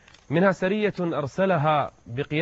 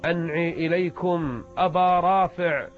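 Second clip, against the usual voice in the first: slow, higher, drawn-out herald's proclamation; death crier; 4 semitones higher, slower than usual. Retold or imitated. Herald announces Abu Rafi's death at dawn, imitated proclamation. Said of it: "سمعت الناعي يقول".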